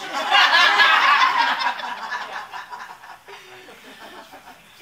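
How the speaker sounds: very loud, high burst of laughter; big laugh. Real, not acted. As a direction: roar with laughter. Laughs at her own story.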